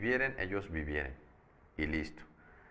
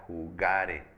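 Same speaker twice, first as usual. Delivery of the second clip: "jugare" with GA punched, final e unstressed; stress on GA; 3 dB louder than usual. Shown as a demonstration. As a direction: land firmly on GA in "jugare". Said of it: "la sílaba tónica es ga".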